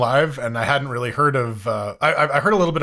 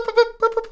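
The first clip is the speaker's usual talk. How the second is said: very high beeping Morse tones; high beeps. Shown as a demonstration. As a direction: chirp it like a beeper. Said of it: "if you go, like, boop".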